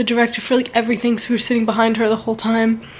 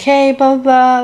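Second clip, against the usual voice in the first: louder, higher mock hello; mimicked video greeting; 4 semitones higher, 6 dB louder than usual. Cousin greeting someone on a phone video, raised mimicked hello. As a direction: call out a placeholder greeting.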